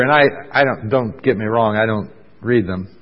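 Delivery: ordinary talk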